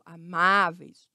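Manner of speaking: amáveis slow, stretched on the open á; amáveis with a wide open stressed vowel. Shown as a demonstration. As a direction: draw out the má in amáveis. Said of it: "a aberto".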